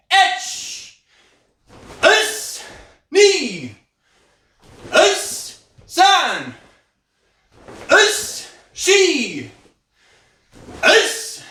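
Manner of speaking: sharp, high shouted counting; kiai count shouts. Real, not acted. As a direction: bark each count out with power. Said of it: "I try to get louder".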